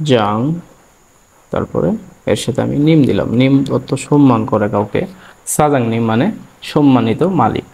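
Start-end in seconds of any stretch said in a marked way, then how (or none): none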